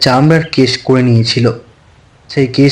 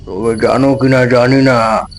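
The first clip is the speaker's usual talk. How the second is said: a lightly lower, measured voice for the elder magician; old magician's voice; 3 semitones lower than usual. A narrator's reading. Old magician confides in young Nabin, lightly lower and measured. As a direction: speak as a grave old master, unhurried.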